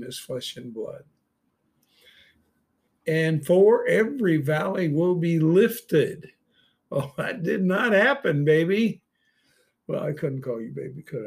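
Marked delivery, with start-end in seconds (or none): 3.1-6.3 loud, higher preaching of the verse; proclaiming. Real, not acted. Preacher booming a verse to his listeners, proclaiming. 6.9-9.0 high, loud, playful outburst; excited aside. Real, not acted. Preacher teasing his online listeners, excited and playful.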